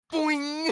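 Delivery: boing sound effect. Pretend. Ears popping up.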